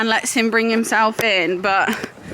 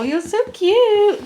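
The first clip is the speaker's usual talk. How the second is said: much higher, slow fond coo; 9 semitones higher, slower than usual. Real, not acted. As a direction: coo over the dogs like they're babies. Cooing at the dogs.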